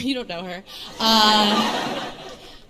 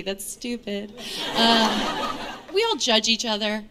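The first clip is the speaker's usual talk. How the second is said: usual speaking voice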